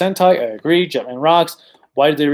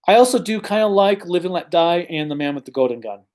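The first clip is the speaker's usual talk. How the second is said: usual speaking voice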